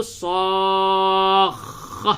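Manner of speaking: صاخ drawn out very slowly, kha held long; doubled kha. Shown as a demonstration. Teacher models the doubled kha very slowly. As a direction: stretch صاخ and hold the final kha. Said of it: "دیکھیے دو خا نکالنا ہے".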